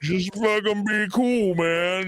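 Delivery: high, slow mock cop voice. Host imitating the East Hampton officer, slow mocking drawl. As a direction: play the smug officer, drawn out. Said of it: "Officer Guy over here at East Hampton P D said".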